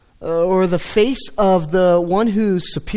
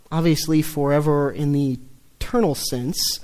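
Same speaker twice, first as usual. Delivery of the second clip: normal talking voice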